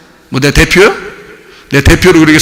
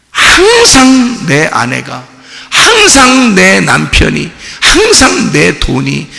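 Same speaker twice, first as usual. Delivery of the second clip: slow preaching shout; 5 semitones higher, much slower than usual. Acted. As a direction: hammer each phrase slowly. Preaching at full force.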